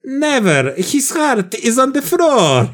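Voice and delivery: high, slow mock Italian accent; hammed-up Italian accent, drawn right out; Jared Leto. Answering whether Leto sounds Italian, exaggerated Italian accent. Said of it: "est-ce que Jared Leto parle avec l'accent italien".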